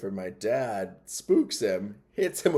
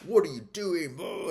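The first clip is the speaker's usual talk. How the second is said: mock yell, pitched up high; retold yelling; 8 semitones higher than usual. Older brother locked out, through the glass, mock yelling. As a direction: imitate an angry brother yelling, without full volume. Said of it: "he's yelling at me through the".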